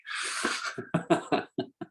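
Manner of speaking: quiet, breath-only laugh; soft chuckle. Real, not acted. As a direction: chuckle softly to yourself. Chuckles at own remark.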